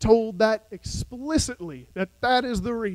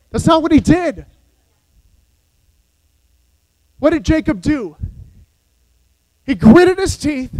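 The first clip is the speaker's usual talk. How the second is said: rising, ringing sermon delivery; full-force Sunday preaching; fiery preaching; 6 semitones higher, 10 dB louder than usual. Acted. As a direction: preach it to the back pew.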